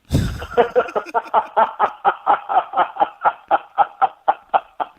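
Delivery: long high-pitched cackling laugh; helpless, loud laughing fit; big burst of laughter. Real, not acted. Interviewer cracking up after his own rant about Charmed, long high laughter.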